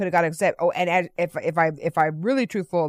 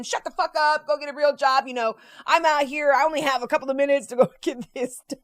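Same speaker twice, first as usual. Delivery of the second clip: mock rude retort; 7 semitones higher than usual. Man quotes cursing the officer.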